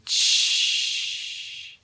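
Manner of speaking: unvoiced hissing "Shh"; gas hiss. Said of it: "hissed at me and said".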